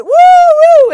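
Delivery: loud, high imitated cheer; crowd whoop. Breakers cheer the dancer, loud whooping.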